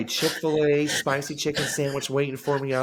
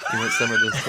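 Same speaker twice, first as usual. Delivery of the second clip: squealing high laughing taunt; high laughing mock taunt; laughing mock offer; 5 dB louder than usual. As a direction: tease him through laughter.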